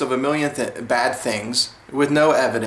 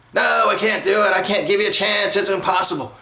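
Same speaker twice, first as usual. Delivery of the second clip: higher, scoffing imitation of a job interviewer; mock dismissive interviewer; 7 semitones higher, 3 dB louder than usual. Job interviewer turning down a free trial, higher mocking dismissive voice. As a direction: act out the boss who flatly won't give him a chance.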